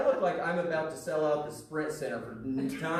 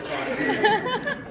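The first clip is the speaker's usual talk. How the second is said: laughing; 4 dB louder than usual. Retold or imitated. Comic's line, laughing.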